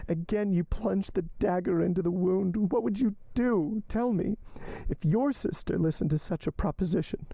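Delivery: high-pitched wounded reproach; Valentine, anguished. Acted. Valentine reproaching Maximilian for wounding her, anguished. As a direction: challenge him high and distressed.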